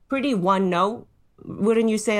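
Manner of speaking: usual speaking voice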